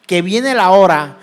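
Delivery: loud, emphatic pulpit declamation; scripture proclamation. Acted. Preacher quotes Christ's promise to congregation, loudly proclaimed. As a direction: drive each phrase home forcefully.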